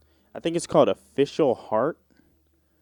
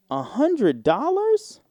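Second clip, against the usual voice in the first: high, slow, rising amazement; amazed; 12 semitones higher, slower than usual. Real, not acted. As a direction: stretch it out, astonished. Stunned again at the gift.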